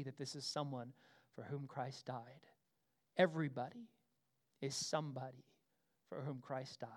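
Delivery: soft, low restatement; hushed. Acted. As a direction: let it sink in quietly. Quiet closing restatement.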